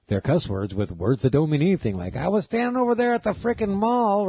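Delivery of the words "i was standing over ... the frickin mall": mock comedian bit. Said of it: "he goes".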